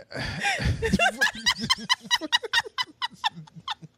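high, helpless run of laughter; real high-pitched cackling fit; long laughing fit. Real, not acted. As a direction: laugh on and on until spent. Long laughing fit mid-story.

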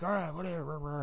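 high, soft brush-off; dismissive shrug. Retold or imitated. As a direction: wave it away like you don't care. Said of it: "he's like".

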